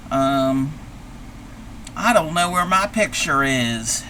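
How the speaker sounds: usual speaking voice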